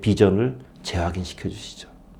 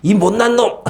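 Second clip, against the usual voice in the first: high, sharp mock rebuke; a pretend angry father snapping high; mock scolding; 9 semitones higher, 3 dB louder than usual. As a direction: scold him as a hopeless fool, hammed up. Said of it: "이렇게 하면서 혼내는 게 아니에요".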